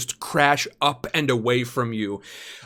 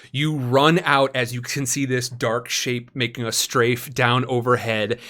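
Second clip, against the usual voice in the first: ordinary talk, nothing marked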